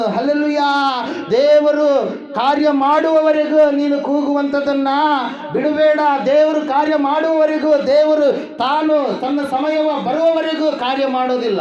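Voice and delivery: high, loud sermon cry; full-force preaching. Acted. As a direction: preach it out to the whole congregation.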